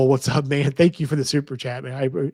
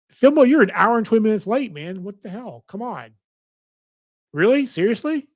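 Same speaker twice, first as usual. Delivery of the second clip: fast, high, loud fake indignation; mock outrage; 7 semitones higher, 3 dB louder than usual. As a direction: playfully scold the latecomer. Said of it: "I'm just messing with you".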